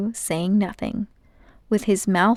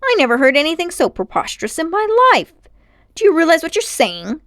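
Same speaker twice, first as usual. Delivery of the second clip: fast, high, loud indignation; preposterous outrage; 8 semitones higher, 6 dB louder, faster than usual. A narrator's reading. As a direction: rattle it off, scandalised. Uncle outraged.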